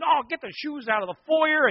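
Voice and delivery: high, loud, frantic housewife griping; shrill-free high panicked scolding of the kids; frazzled Martha. Acted. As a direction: fuss like a harried host tidying for a guest. Martha voiced fussing.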